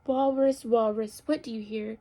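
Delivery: measured, expressive question and answer; picture-book read-aloud. A narrator's reading. Teacher reads animal story to children, expressive storytelling. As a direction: read it out clearly for small children.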